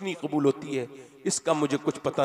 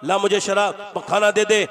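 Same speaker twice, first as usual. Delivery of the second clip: loud, high, rough demand for liquor and food; drunken demand; 6 semitones higher, 10 dB louder than usual. Acted. Drunk son shouting at his old mother for liquor.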